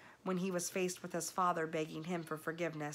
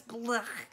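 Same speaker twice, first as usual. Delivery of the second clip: disgust noise; 4 semitones higher than usual. Acted. Disgusted rat.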